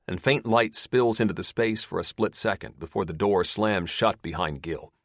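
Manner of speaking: usual speaking voice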